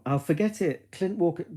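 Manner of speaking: normal talking voice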